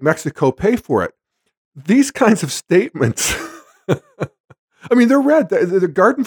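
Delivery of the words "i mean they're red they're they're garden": high-pitched, laughing as you talk; real laughing. Real, not acted. Commentator amused by the candidate's statements, laughing. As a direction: break into a laugh and talk through it. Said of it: "I've gotta stop laughing about this".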